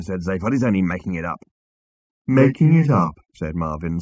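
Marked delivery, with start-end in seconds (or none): 2.3-3.1 louder, higher robot echo; mock astonishment. A narrator's reading. Marvin echoing Zaphod's accusation, mock astonishment. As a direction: throw it back in droning mock disbelief. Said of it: "in a parody of astonishment".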